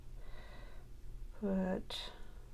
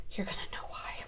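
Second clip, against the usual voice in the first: whispered aside; 10 dB quieter, faster than usual. Real, not acted. Joking dark aside.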